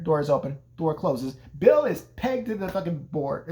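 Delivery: ordinary talk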